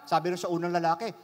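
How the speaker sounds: normal talking voice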